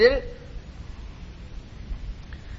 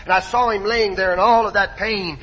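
usual speaking voice

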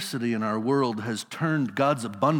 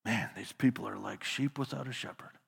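soft, much quieter murmur of tender concern; a quiet sigh of compassion over the crowd; loving concern; 3 semitones lower, 10 dB quieter than usual. Acted. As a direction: mutter it under your breath with love. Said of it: "mutter under his breath in the tone of loving concern".

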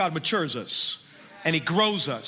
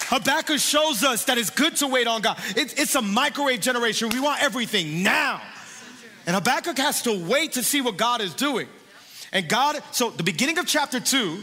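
preaching; 4 semitones higher than usual. Real, not acted. Preaching continues.

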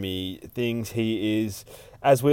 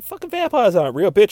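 higher, louder, quick acted comeback; lightly hammed-up dismissal; mock scornful retort; 11 semitones higher, 7 dB louder, faster than usual. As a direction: imitate a scoffing disbeliever. Acted scoffing retort in retold argument.

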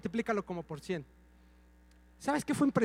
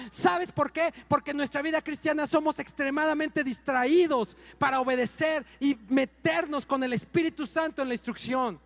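ordinary delivery

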